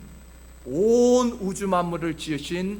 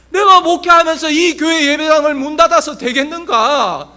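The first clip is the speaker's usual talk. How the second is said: loud, higher-pitched worried lament; anguished outcry; 8 semitones higher, 12 dB louder than usual. Retold or imitated. Pastor fearing the chapel will close, anguished outcry. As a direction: cry out the retold dread. Retold anguish over losing the church.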